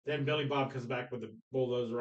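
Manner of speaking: usual speaking voice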